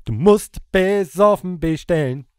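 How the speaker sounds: slowly reciting the misheard lyric; misheard lyric recited slowly. Shown as a demonstration. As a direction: spell out the misheard line slowly. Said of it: "es muss heißen".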